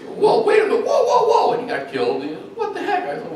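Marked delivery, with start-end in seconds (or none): 0.1-1.5 high, loud, panicked whoa; the boss's character getting jumped by gnolls, in mock panic. Boss panicking as gnolls attack. 2.5-3.0 high, startled what the heck; the boss after his character is killed, in mock outrage. Boss dying in game, outraged.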